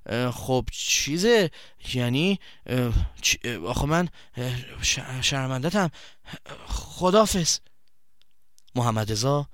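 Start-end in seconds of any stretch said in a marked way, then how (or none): 0.1-7.6 slow, faltering, broken-off words; ashamed friend stumbling toward sorry; stammering shame. Acted. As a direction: struggle to get the apology out. Ashamed boy stammering an apology.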